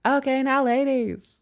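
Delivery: high hyped [name] callout; [name] voice. Retold or imitated. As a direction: call out to the ladies like the song. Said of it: "Andre three thousand".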